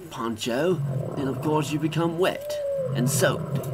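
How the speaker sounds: usual speaking voice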